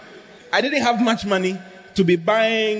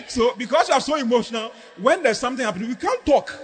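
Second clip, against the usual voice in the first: ordinary delivery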